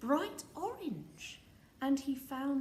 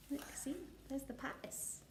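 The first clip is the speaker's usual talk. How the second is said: whisper aside; 2 semitones higher, 10 dB quieter, faster than usual. Real, not acted. Parent whispering to the child about the palace. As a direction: point it out in a hush.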